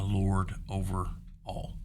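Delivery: ordinary talk, nothing marked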